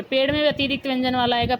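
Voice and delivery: normal talking voice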